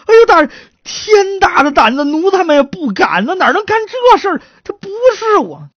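high, loud pleading; panicked denial. Acted. Accused underling denying he swapped the corpse, panicked. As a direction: protest innocence desperately. Suspect denies the accusation, begging.

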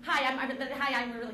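normal talking voice

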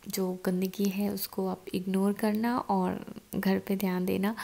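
normal talking voice

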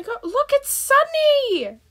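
light up at seeing her; excited cry. Real, not acted. Excitedly spotting Sunny arrive in the game.

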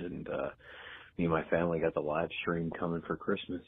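ordinary talk

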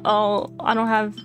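ordinary delivery

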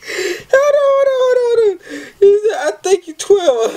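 very high, loud squealing laughter; can't stop laughing, squeaking out words; cry-laughing. Real, not acted. Telling viewers to wait, cry-laughing.

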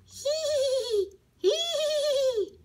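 high, slow drawn-out neigh; whinny like a horse, stretching it out. A narrator's reading. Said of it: "relinchó el caballo".

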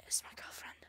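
whispered secret. Shown as a demonstration. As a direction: hush it to nobody. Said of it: "said aloud first".

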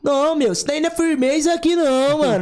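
playful paulista impression; São Paulo accent. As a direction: do the São Paulo guy, "meu" and "mano". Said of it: "Paulista fala".

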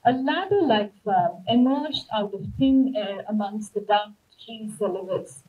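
ordinary delivery

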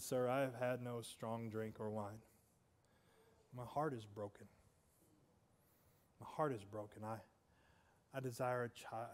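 broken-hearted plea. Acted. Hannah pleading with the priest, softly broken-hearted. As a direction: let the heartbreak weigh every word. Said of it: "deep sobs".

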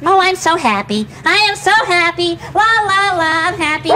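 bouncy, hammed-up joy turning singsong; make-believe happy. Showing the dog how by example, pretend happy. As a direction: throw yourself into pretending you're overjoyed. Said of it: "Make believe you're happy".

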